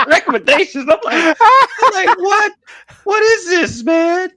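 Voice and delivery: high, loud, laughing disbelief; cracking up. Real, not acted. Friend recounts waking up to weird recommendations, cracking up. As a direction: squeal it out through real laughter.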